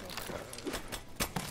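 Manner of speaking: slow, breathless whisper; nervous whisper. Real, not acted. Streamer whispers to self while bracing for the attack, nervous whisper. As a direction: barely breathe it out, tense.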